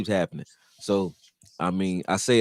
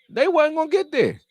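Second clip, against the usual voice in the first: outraged protest; 3 dB louder than usual. Real, not acted. Arguing a penalty call.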